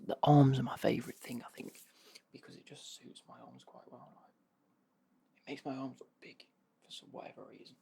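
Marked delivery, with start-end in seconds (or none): 0.1-1.4 loud, eager whisper; excited whisper. Real, not acted. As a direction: burst of enthusiasm in a whisper. Enthusing about the shirt.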